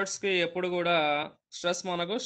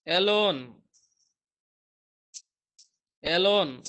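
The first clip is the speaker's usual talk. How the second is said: alone with lone said louder; stress on LONE; 3 dB louder, much slower than usual. Shown as a demonstration. Teacher showing students where alone takes stress. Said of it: "main word కి starting letter కి మీరు stress".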